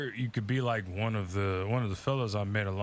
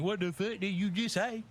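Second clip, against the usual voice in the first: indignant; 8 semitones higher than usual. Retold or imitated.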